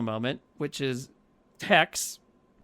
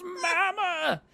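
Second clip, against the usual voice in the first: high, wailing cry of Mama; hammed-up crying wail; mock crying wail; 12 semitones higher than usual. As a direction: sob the name like a grieving son. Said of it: "Tink goes".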